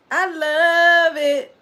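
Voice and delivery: high, drawn-out delight; happy. Real, not acted. Streamer loving the new name Fortune Cookers, high, drawn-out delight. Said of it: "I'm happy about that".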